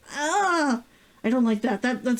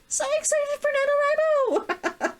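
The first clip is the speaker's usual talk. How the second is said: high, giggly, bursting with glee; giddy excitement. Real, not acted. Vlogger gushing to viewers about the writing month, giddy with glee. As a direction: squeal it, laughing, can't contain it.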